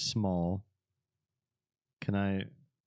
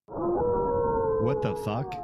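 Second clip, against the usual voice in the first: stunned, drawn-out shout; shocked shout; 5 dB louder, much slower than usual. Real, not acted. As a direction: yell it in total disbelief. All-time record shattered.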